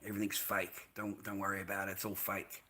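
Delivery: very soft, low retelling; low, soft, weary-sounding dismissal; quiet reassurance. Retold or imitated. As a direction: play it down, hushed and subdued. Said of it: "I've told them a few times".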